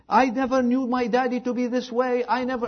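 high, mock outburst of a child; mock screaming daughter. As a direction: act out a daughter's wailing complaint, mildly. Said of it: "she's screaming".

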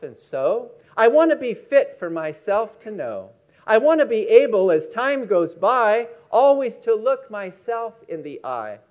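solemn recital to a listening congregation; preacher reads the poem Myself to close the message; poem reading. Acted.

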